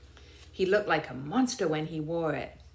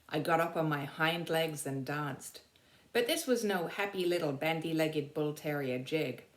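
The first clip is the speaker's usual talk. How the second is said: ordinary delivery, nothing marked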